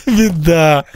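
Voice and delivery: usual speaking voice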